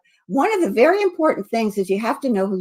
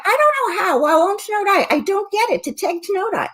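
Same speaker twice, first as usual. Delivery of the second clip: words said in reverse order, higher; backwards speech; 10 semitones higher than usual. Shown as a demonstration. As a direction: play it backwards. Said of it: "I start at the end".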